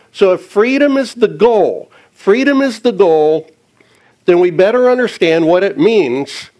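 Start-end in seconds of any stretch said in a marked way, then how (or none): none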